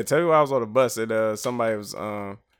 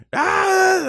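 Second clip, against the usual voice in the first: mock rock-star wail; 8 dB louder than usual. Imagining a rock star wailing.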